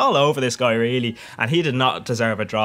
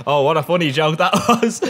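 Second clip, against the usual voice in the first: usual speaking voice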